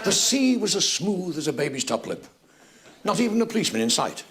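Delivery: music-hall rhyming verse, declaimed for laughs; comic monologue recitation. Acted. Comedian recites a Billy Bennett monologue to the audience, comic verse recitation. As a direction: recite a mock-heroic sea poem to the audience.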